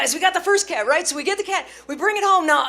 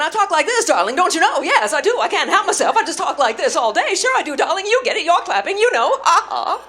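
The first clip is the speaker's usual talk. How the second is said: high, loud classic-film diction; hammed-up forties movie darling, playing to the crowd; old-movie star voice; 5 semitones higher, 10 dB louder than usual. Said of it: "I walk out of there talking funny".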